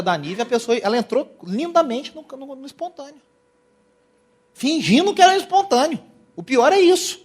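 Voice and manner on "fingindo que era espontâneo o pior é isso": indignant. Real, not acted. Mocking fake spontaneous worship.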